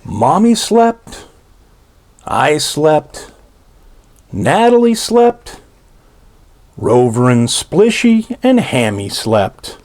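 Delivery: plain reading voice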